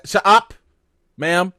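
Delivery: snapping at Tabitha on the TV, exasperated; loud shut up. Retold or imitated. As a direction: cut her off sharply.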